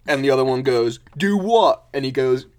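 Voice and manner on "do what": loud, high-pitched retort; hammed-up indignant comeback; defensive mole. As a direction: throw it back loudly, baffled. Accused mole answers back.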